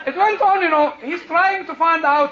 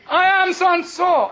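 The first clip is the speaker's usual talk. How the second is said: mock-important, raised pitch; pompous self-announcement; 6 semitones higher, 4 dB louder than usual. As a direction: announce yourself like a big shot. Celebrity announces himself pompously.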